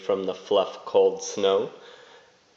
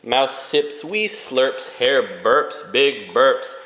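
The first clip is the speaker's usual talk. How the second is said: plain reading voice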